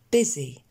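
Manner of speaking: "Busy" with an è-like first vowel, bezzy; pronunciation model. Shown as a demonstration. Teacher showing the unexpected E sound, pronunciation model. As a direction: say "Busy" with the U as a short E. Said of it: "Encore une fois".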